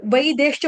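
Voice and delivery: वैदेश्च with "ai" first and "e" second; mistaken vai-desh. Shown as a demonstration. Teacher demonstrating a common slip, वैदेश्च as "vai-deshcha". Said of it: "बोला जाता है गलती से".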